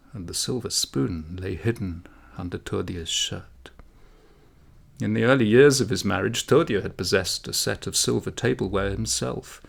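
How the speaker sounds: ordinary delivery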